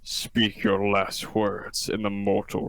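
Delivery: slow, grand sentence of doom; mock-epic cop. Cop demanding a thief's last words, mock-epic. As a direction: intone it slowly like an avenger.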